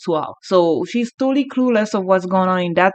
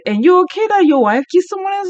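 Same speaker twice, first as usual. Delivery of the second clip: much higher and louder, disbelieving; shocked question; 11 semitones higher, 5 dB louder than usual. Retold or imitated. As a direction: ask it in shock, voice jumping up. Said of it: "look at the husband and say".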